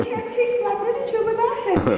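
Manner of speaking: laughing through the words, pitch way up; giggling it out high; retelling her naive answer while laughing. Real, not acted. Laughing through retold reply.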